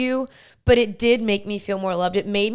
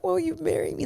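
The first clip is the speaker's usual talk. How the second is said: choked up; 8 semitones higher, 5 dB quieter than usual. Retold or imitated. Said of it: "hearing you get choked up".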